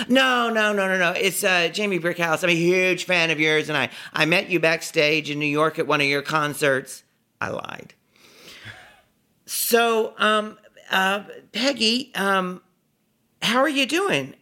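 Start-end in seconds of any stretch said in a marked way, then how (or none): 0.1-7.0 high, loud, eager gushing; flustered fan. Retold or imitated. Fan corrects Peggy and claims they met, flustered high gushing. 9.5-14.3 high, halting, over-eager small talk; nervous fan. Retold or imitated. Starstruck fan fumbles for talk with Peggy, nervous and high.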